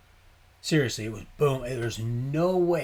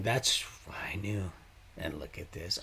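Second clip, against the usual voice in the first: whisper; 4 semitones lower, 5 dB quieter than usual. Real, not acted. Aside while filming.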